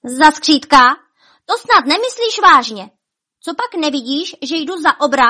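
high, loud indignant protest. A narrator's reading. Bonifác mistaken for a sprite, cross and indignant. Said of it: "Bonifác vztekle zavrtěl hlavou".

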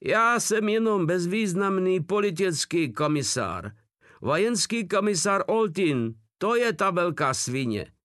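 acted frantic prisoner blaming another; desperate protest. A narrator's reading. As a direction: plead innocence in a high cry. Prisoner cries out his innocence.

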